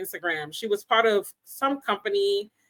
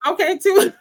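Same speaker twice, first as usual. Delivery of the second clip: laughing; 11 semitones higher, 6 dB louder than usual. Real, not acted. Laughing at cohost's urging.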